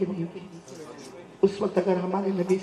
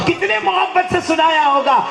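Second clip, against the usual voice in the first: much higher, louder, slow impassioned repeat; rising to full preaching force; 13 semitones higher, 10 dB louder, slower than usual. Shown as a demonstration. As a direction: lift the question into a fervent cry. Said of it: "preacher repeats the question louder". (بلند آواز میں پُرجوش خطابت)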